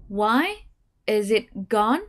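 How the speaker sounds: slow "Why is it gone?" with pauses, rising on "gone"; staircase intonation. Shown as a demonstration. Teacher models the practice question. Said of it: "Chúng ta có câu tiếp theo".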